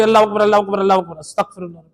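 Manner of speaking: Allah Akbar gabbled fast and clipped as "Allah kbar"; mocking hasty recital; rushed wrong recitation. Shown as a demonstration. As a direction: rattle off Allah Akbar carelessly, swallowing syllables. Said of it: "یہ تو غلط پڑھتے ہیں".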